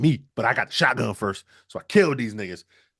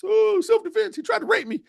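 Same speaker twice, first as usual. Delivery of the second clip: much higher innocent whimper; fake victim to cops. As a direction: play the helpless victim on the phone. Son calling the cops.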